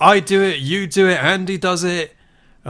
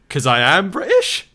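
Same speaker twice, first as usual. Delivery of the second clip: higher, slow, questioning reply; confused; 5 semitones higher, 4 dB louder, slower than usual. Retold or imitated. Guest imitating an actor answering an interviewer, confused. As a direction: imitate baffled disbelief, slowly. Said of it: "he's, like, really confused".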